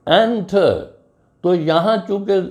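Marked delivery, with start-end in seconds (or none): 0.1-0.7 enter with एन punched high; enter said with the opening syllable pushed; stress on first syllable. Shown as a demonstration. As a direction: lean hard into the एन of enter. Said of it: "जोर ई, एन पर है".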